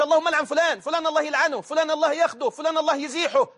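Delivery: high-pitched mock rattle of curses; mimicked cursing. Mimics people cursing someone in prayer. (تقليد من يدعو على الناس باللعن بصوت مرتفع الطبقة)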